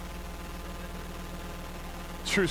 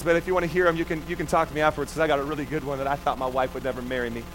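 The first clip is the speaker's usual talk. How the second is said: usual speaking voice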